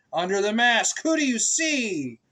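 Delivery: rising, questioning refrain; sing-song refrain. A narrator's reading. Asking the child the book's refrain, sing-song.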